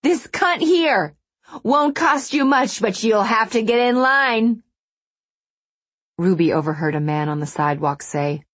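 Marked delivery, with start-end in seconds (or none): 0.0-4.6 high, loud, drawn-out public denunciation; jeering yell. A narrator's reading. Wronged wife advertising her rival to passers-by, jeering yell. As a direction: yell it slowly to the whole sidewalk. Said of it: "she yelled and pointed".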